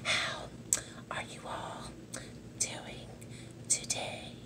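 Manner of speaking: imitating a soft ASMR whisper; mock ASMR whisper greeting. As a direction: whisper each hello to the camera like an ASMR host. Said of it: "you're whispering".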